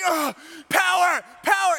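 high, stretched-out mock cries; imitated dazed kids. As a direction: cry out like a bowled-over child.

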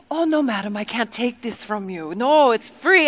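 protesting in a faked Congolese accent; mock Congolese accent. Tea lady refuses the quarters, mock Congolese accent. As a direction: imitate her polite refusal with Congolese accent. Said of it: "her accent sounded nothing like this".

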